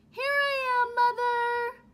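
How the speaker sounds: put-on high, loud call of a baby bird. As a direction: call out to the plane overhead. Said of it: "he called out".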